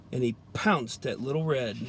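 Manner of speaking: ordinary delivery, nothing marked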